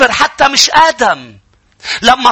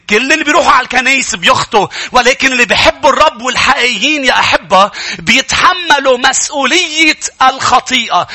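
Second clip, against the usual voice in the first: normal talking voice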